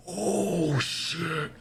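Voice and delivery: high, mock-stunned "Oh, shit"; imitating shocked students. Students watching the near knockout, mock shock. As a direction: ham up the crowd's shocked gasp. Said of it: "all the other students go".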